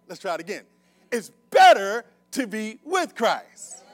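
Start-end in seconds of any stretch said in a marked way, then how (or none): 1.1-3.8 loud, slow, word-by-word proclamation; emphatic preaching shout. Real, not acted. Preacher trying the congregation once more, shouting slowly. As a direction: belt it out slowly, punching "better" and "with". Tries again for a response.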